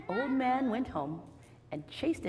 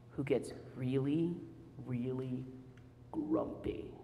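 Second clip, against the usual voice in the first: each really softer, grumpy whispered; fading to a whisper; 9 semitones lower, 7 dB quieter, slower than usual. Shown as a demonstration. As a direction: sink down to a secret whisper. Building suspense for children.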